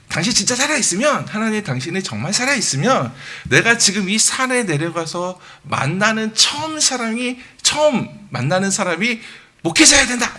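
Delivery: high-pitched imitation of a drunken challenge to God; retold shout. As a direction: act out a defiant yell, rising to the last words. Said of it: "이렇게 소리치고". (따지듯 높게 외치는 흉내)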